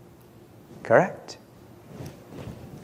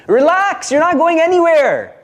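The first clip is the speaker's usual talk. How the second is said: high, loud mock reassurance; teasing guru; 14 semitones higher, 3 dB louder than usual. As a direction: reassure him, with the punchline hidden inside.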